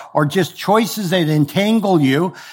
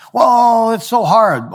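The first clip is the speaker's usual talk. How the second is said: mock whine; 6 semitones higher, slower than usual. Believer whining to God.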